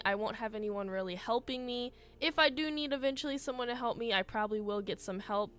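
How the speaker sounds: ordinary talk, nothing marked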